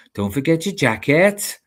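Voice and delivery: slow, lilting Welsh practice phrase; Welsh accent. Shown as a demonstration. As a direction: ease into a sing-song Welsh accent. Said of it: "just go into the accent".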